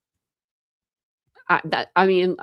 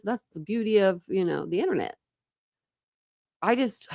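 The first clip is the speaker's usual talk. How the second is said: ordinary talk, nothing marked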